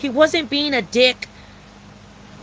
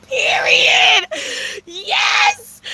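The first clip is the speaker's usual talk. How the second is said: squealed agreement; 11 semitones higher, much slower than usual. Real, not acted.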